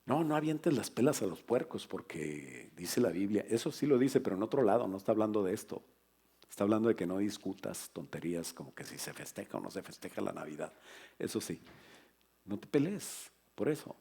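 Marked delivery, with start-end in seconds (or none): none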